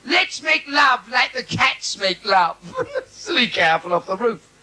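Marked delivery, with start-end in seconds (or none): 0.1-2.6 mock wife. Comic quotes wife, slow and loud.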